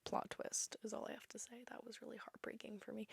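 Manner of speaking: whispered aside. Real, not acted. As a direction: whisper it like a secret spoiler.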